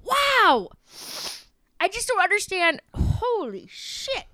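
high, amazed at the heat; high, slow disbelief; stunned. Real, not acted. Burning from hot jelly bean.

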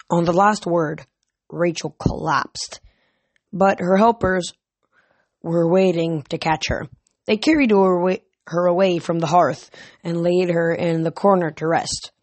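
ordinary delivery

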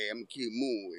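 put-on muddled New York-Jersey accent, low pitched; Kingpin. Co-host inventing a new villain voice, botched Jersey accent. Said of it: "I don't know what accent that is".

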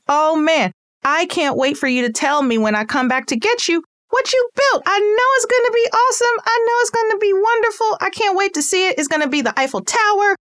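put-on high, gushing praise for a toddler; excited praise. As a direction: pile on the praise like a hyped parent. Parent hyping child at drop-off.

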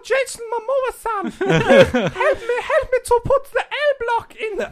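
put-on very high squeaky falsetto, accent wobbling; anime schoolkid. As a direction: plead in a hammed-up high anime-kid voice. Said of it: "like, Japanese to, ... little Dutch schoolboy".

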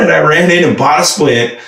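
ordinary talk

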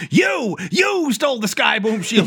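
loud, high, slow yell; hammed-up angry accusation; mock accusation. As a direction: pretend furious shout. Imagined Smokescreen accusing Hotshot.

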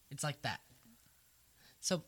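ordinary talk, nothing marked

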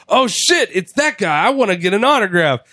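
excited fan. Retold or imitated. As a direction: gush at spotting a star in the airport. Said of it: "I'd be like".

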